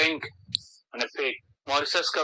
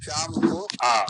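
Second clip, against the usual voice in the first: शाम को आ pitched high and louder, word by word; split words, higher; 12 semitones higher, 4 dB louder, slower than usual. Shown as a demonstration. Teacher splits the name again as a call. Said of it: "शमुआ".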